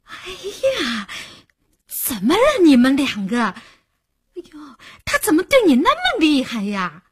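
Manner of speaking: high, loud, theatrical shock; feigned alarm. A narrator's reading. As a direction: exclaim with exaggerated fright. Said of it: "做惊恐状地问道".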